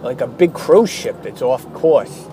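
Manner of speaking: usual speaking voice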